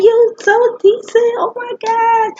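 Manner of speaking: very high, loud and slow squeal; cheering. Retold or imitated. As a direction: scream your praise for her. Friends lift her up cheering.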